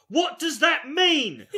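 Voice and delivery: high, loud, drawn-out disbelief; exasperated shout. Real, not acted. Comic baffled by "anchoring her", loud exasperated disbelief. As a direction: cry it out slowly, baffled and half laughing.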